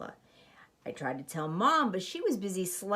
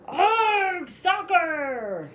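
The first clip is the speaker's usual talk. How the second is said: much higher, louder crew shout; crew yell; 10 semitones higher, 6 dB louder, much slower than usual. Acted. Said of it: "the crew yelled".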